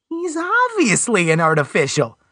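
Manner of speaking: high, slow insistent voice; insistent Alan. A narrator's reading. Alan insists to Westwood, slow and high.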